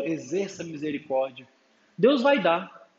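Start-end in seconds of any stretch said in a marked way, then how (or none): 0.0-1.5 quietly resigned repeat. Pretend. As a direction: lower and softer, giving in. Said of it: "Pô, que saco de novo".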